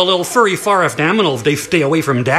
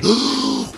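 startled gasp; 7 semitones higher, 3 dB quieter than usual. A narrator's reading. Narrator gasps at the bear.

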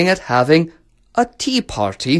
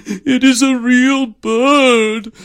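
high, slow, drawn-out wail; acted sobbing; 11 semitones higher, 4 dB louder, much slower than usual. A narrator's reading. Emperor corrects his daughter, wailing mock sob. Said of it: "sobbed the emperor".

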